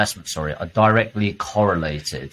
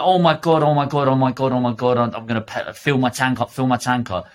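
mock panic; 3 semitones higher than usual. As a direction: play the frantic driver rushing to fill up. Panicked driver at pump.

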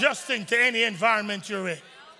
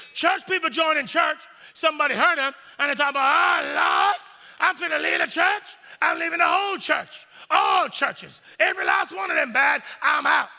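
loud, higher mock complaining; mock church-hurt rant; 4 semitones higher, 3 dB louder than usual. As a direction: ham up a wounded member storming off. Preacher acting out church-hurt member.

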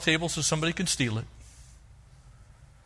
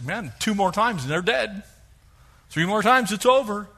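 louder, exasperated countdown to revenge; mock fed-up threat; 7 semitones higher, 5 dB louder than usual. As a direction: grit it out like you're done with them. Grudge holder counting down.

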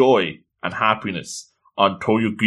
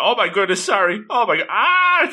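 higher panic rising to a mild mock scream; mock panicked scream; 8 semitones higher than usual. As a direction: imitate a girl working up to a scream. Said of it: "she starts screaming".

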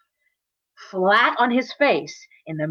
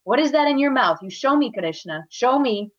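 higher, emphatic scolding mother; insist, allowing no refusal; stern demand; 5 semitones higher, 3 dB louder than usual. Acted. Mother ordering son to show his mouth, stern. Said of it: "very sternly".

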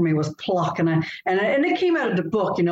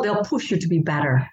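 ordinary talk

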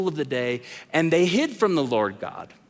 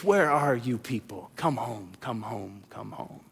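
fading tender call; 8 semitones lower, 7 dB quieter than usual. Acted. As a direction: lower and softer with each word, ending in a whisper. Jesus calling the outcasts home.

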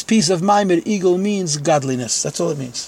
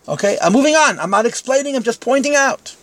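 high-pitched protesting aside; emphatic disclaimer; 8 semitones higher than usual. Real, not acted. Teacher telling students he is only pointing out, protesting. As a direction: back off the argument, still worked up and high.

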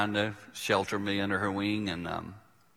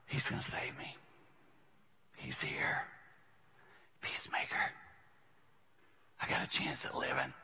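gasp, then whisper with relief; hushed, spaced-out whisper of hope; awed whisper; 4 semitones higher, 11 dB quieter than usual. Retold or imitated. Little boy seeing his rescuer grandfather, hushed whisper.

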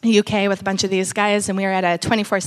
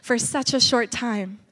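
prayer; 5 dB quieter than usual. Acted. Soft prayer over the people.

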